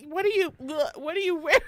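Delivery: grossed-out mock teen voice; disgusted teen. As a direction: act out a teen gagging on spray. Mocking teens' body spray.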